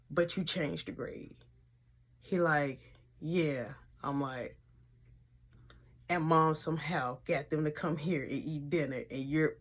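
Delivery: low, soft hushed back-and-forth; whispered exchange. Retold or imitated. Two kids whispering about the teacher at dinner, hushed. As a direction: keep it low, they're just in the next room.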